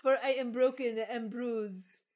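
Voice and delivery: lightly hammed-up lament of a cake; talking cake. Cake lamenting to the witches, lightly acted high voice. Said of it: "said the cake".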